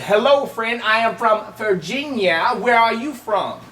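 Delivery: slowed, each word spelled out for lip reading; over-enunciate it slowly like to a deaf man; slow exaggerated mouthing. Telling the silent stranger again, slow and over-articulated. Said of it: "so as he could read my lips".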